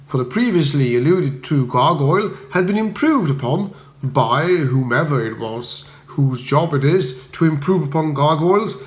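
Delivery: usual speaking voice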